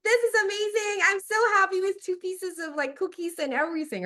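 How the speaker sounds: put-on high, loud, gushing child voice; overjoyed child thanking grandma; excited kid thanking. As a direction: squeal thanks like a delighted little kid.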